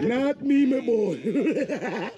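laughing through the line; giggling as he repeats it. Real, not acted.

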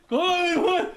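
very high, loud frantic cry; hammed-up panic call; mock panicked yell. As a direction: shriek it for help. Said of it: "I'd be on the floor going".

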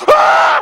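very high, loud open-mouthed cry; mock ecstatic shriek; mock delighted yell. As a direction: holler with a mouthful of hot donut. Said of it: "I be like".

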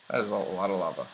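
talking with hiccups; hiccuping through the words. Real, not acted. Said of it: "I have the hiccups now".

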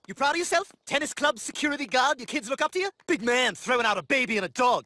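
ordinary talk

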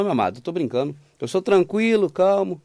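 ordinary talk, nothing marked

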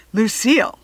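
loud scolding principal; exasperated principal yelling; yelled. Acted. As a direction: tell her off. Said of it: "the principal yelled".

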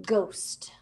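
ghost as a spooky whisper. Shown as a demonstration. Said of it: "I'll say that again".

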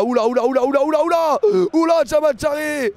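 climbing string of high warnings; alarmed exclamations. Real, not acted. Commentator seeing Charé head for supporters, increasingly alarmed. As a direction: build from startled to full alarm.

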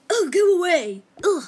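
loud crying yell; angry shout. Pretend. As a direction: yell it away in a fit. Shoving robot away.

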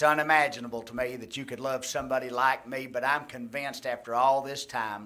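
earnest pastor's prayer, steady and sincere; closing prayer. Acted. Pastor praying over the congregation, earnest and steady. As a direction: pray it aloud for the whole room.

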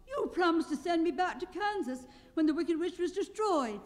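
Dorothy. A narrator's reading. Dorothy reminding Oz of his promise, in a girl's voice. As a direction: speak as a young girl pleading. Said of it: "said the girl".

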